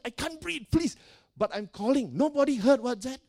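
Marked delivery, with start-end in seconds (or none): 0.0-1.0 strained high begging, imitated; retold cry for help. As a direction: re-enact a breathless plea. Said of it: "And I was calling".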